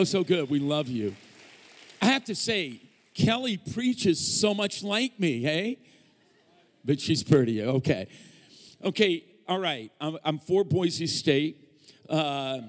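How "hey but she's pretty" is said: ordinary talk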